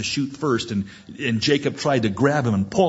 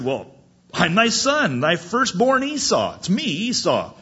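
raised pitch, insistent acted announcement; Esau answering; 7 semitones higher, 3 dB louder than usual. Firstborn son telling his father who he is, high insistence. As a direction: pitch it up, identifying yourself to father.

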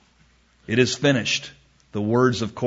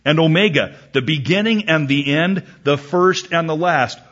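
high, ringing Bible verse; scripture proclamation; 4 semitones higher, 4 dB louder than usual. Acted. Preacher reads God's titles from Revelation, proclaiming. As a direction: declaim each title with authority.

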